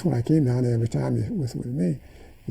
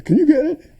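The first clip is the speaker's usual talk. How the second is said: excited student; 14 semitones higher, 9 dB louder than usual. Retold or imitated.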